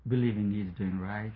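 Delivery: normal talking voice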